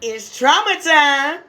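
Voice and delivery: high, loud, drawn-out call; stretched high holler; mock announcement. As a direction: sing out a mock show-time announcement. Mock announcement of painful routine.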